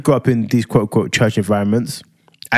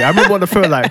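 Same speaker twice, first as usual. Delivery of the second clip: ordinary delivery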